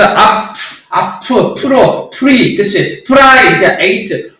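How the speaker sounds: 앞, 프로, 프리, 프라이, 에이트 split slowly; slow syllable drill; chunked syllables. Shown as a demonstration. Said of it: "발음을 어떻게 해야 돼?".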